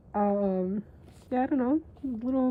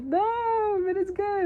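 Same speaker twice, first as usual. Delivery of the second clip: much higher, pleading that it's good; playful high-pitched protest; high defensive protest; 10 semitones higher, slower than usual. Retold or imitated. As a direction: squeak out a defense of the manga. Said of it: "And I'll be like".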